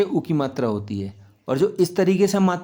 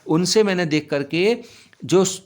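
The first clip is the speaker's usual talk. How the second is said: ordinary talk, nothing marked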